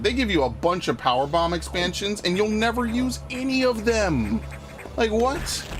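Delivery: high-pitched baffled complaint; exasperated disbelief. Real, not acted. Player griping about useless Metroid expansions, exasperated disbelief. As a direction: protest the absurdity, pitch climbing.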